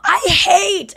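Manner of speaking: high, loud mock yell; imitated shout for Mom. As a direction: holler it like an overheard complaint. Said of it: "He probably just heard, like".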